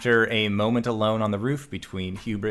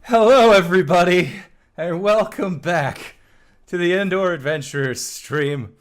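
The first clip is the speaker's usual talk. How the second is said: out of breath; 4 semitones higher, 5 dB louder than usual. Real, not acted. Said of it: "I might sound like I am out of breath".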